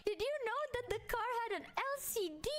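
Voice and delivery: put-on high, proud kid voice; high childlike pride. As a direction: show off like a little kid. Proud to dad.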